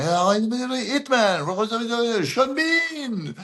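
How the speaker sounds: high, drawn-out French DJ hype, lightly mimicked; hyping DJ announcer. French DJ hyping the crowd for Sean Bean: drawn-out, high hype call. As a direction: stretch the name out like a club DJ working the crowd.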